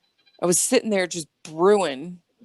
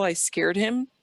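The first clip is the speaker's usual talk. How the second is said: usual speaking voice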